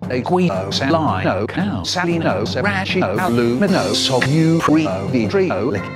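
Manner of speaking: ordinary delivery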